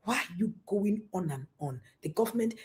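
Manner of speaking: ordinary delivery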